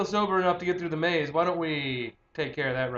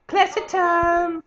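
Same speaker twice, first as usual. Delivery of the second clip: high, slow, put-on posh "Classy time"; hammed-up high posh voice; mock posh voice; 12 semitones higher, 6 dB louder, slower than usual. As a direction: croon it like a snooty aristocrat.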